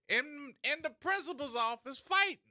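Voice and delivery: mock outrage. Outraged exclamation.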